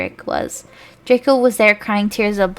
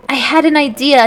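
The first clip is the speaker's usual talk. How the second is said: higher, louder exclaim; 5 semitones higher, 6 dB louder than usual. A narrator's reading. Boy exclaiming his sudden idea, higher and louder. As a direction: burst out with the idea. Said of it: "he exclaimed".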